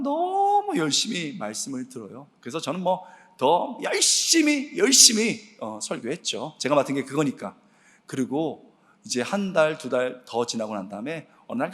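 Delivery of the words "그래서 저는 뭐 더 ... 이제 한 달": normal talking voice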